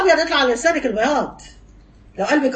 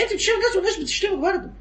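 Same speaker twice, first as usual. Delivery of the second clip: fast rebuke; 6 semitones higher, 3 dB quieter, faster than usual. Real, not acted. Rapid rebuke.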